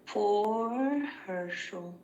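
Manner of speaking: drawn-out mock pity; sarcastic Cossidon taunt. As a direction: stretch it slowly, dripping with false sympathy. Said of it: "sarcastic voice that Sybil can".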